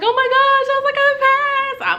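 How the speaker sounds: very high, loud joyful cry; telling family on the phone she passed, screaming. Retold or imitated. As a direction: shriek it out through happy tears. I'm, like, crying.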